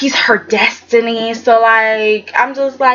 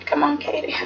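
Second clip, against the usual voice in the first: exasperated plea; 3 semitones higher, 7 dB quieter than usual. Real, not acted. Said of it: "I was just like".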